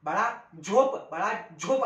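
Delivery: झोप with a palatal jyo, wrong, slow; sing-song wrong झोप. Shown as a demonstration. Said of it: "असं म्हणतो का आपण?". (चुकीचा झोप)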